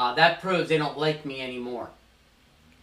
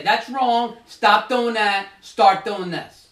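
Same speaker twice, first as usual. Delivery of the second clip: louder, slow, blunt orders; bull in a china shop; 4 semitones higher, 8 dB louder, much slower than usual. Shown as a demonstration. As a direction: bark the commands like a bull charging in. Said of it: "come in like a bull in a china closet".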